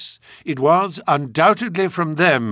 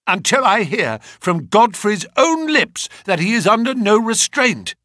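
firm and raised; 8 semitones higher, 4 dB louder than usual. Acted. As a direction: stand his ground, insistent. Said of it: "said my client firmly".

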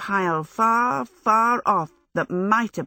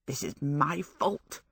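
softer, muttering self-blame; muttered to herself; 3 semitones lower, 7 dB quieter, slower than usual. A narrator's reading. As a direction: mutter under your breath. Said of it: "she muttered".

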